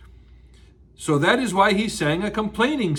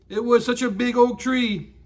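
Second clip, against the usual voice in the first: ordinary delivery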